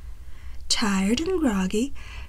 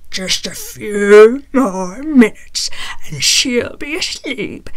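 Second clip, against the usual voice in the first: gloating dinosaur; 8 dB louder than usual. A narrator's reading. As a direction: savor it like a monster waiting to eat.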